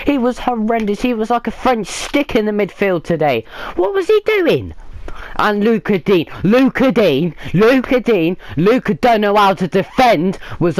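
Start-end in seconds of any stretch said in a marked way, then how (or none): 3.8-4.7 high, incredulous outburst; exasperated. Real, not acted. Fan raging at the midfielder's display, high and incredulous. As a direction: throw your hands up in disbelief.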